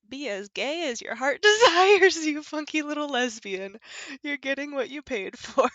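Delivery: loud laughter breaking through; laughing. Real, not acted. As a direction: crack up mid-sentence. Laughs while reading the game text.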